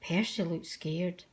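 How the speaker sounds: plain reading voice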